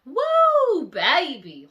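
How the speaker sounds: very high, loud whooping woo; overwhelmed woo. Retold or imitated. Reliving the heavy feeling after the dream. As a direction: release the weight with a cry. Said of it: "And I'm just like".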